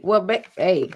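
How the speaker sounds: ordinary talk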